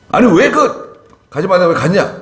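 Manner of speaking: high, loud scolding outburst; rebuke. Acted. Preacher voicing God's rebuke, high angry scolding. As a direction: scold them hard for disobeying. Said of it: "이 책망은요".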